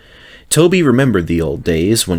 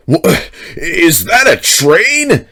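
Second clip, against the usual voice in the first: high, louder, slow startled lorry driver; demanded; 9 semitones higher, 4 dB louder, slower than usual. A narrator's reading. Lorry driver climbing from the ditch, astonished demand. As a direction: splutter it out in disbelief. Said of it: "the man demanded".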